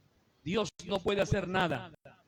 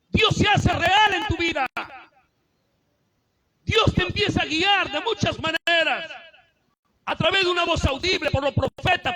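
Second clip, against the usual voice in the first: higher, louder shouted sermon, slowing on key words; full-force preaching; 9 semitones higher, 9 dB louder than usual. Real, not acted. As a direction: build and drive it home to the congregation. (predicación a todo pulmón, más alta y fuerte)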